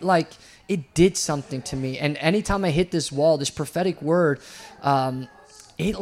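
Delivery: ordinary talk, nothing marked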